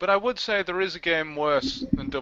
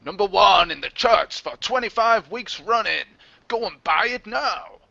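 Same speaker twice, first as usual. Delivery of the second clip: loud, high hyped ad read; advert voice; 5 semitones higher, 6 dB louder than usual. Retold or imitated. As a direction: sell it like a cheesy advert. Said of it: "It said".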